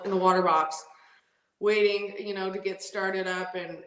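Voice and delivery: usual speaking voice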